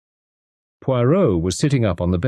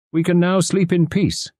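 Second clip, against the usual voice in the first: high, cheerful detective voice; happy Poirot; 11 semitones higher than usual. A narrator's reading. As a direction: declare it with contented relief. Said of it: "he declared happily".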